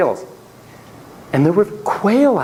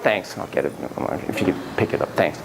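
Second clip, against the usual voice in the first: dry polite reply; 11 semitones lower, 6 dB quieter than usual. Retold or imitated. Reply to pen thrown at her.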